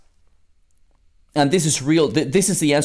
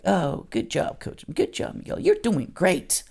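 soft, hushed mock praise; a lightly voiced gentle cheerleader; mock gentle pat on the back; 3 semitones higher, 6 dB quieter than usual. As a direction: imitate a too-soft coach patting him on the back. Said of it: "somebody else who's just gonna give me a little pat in the back".

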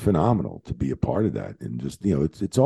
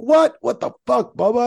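much higher, louder disbelieving shout; shocked outburst; 5 dB louder than usual. Retold or imitated. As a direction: yelp it in total disbelief. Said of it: "I'm like".

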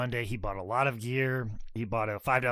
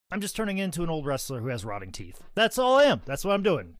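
ordinary talk, nothing marked

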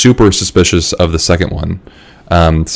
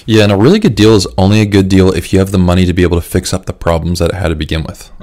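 ordinary talk

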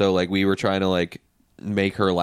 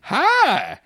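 much higher, squeaky cowboy greeting; 3 dB louder than usual. Shown as a demonstration. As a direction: chirp it like a cartoon cowboy. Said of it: "like, a, a cowboy voice".

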